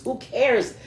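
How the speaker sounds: ordinary delivery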